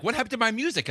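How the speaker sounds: high-pitched, puzzled protest acted out; retold indignant surprise. Husband whose music suddenly stopped upstairs, high mock-indignant protest. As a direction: replay your bewildered complaint, pitched right up.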